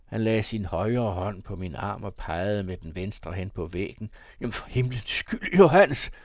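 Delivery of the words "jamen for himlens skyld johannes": high exclamation, building to a loud cry; startled shout. A narrator's reading. Arthur pointing at the portrait, shouting. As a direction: cry out in alarm, louder on the name. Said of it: "råbte han".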